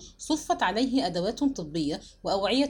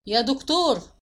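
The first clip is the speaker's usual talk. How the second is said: raised, commanding summons; thunderous call; 3 semitones higher, 4 dB louder than usual. A narrator's reading. Husband summoning the doctor from the door, thundering call. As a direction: call out to the far end of the house. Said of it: "وصحت بصوت كالرعد".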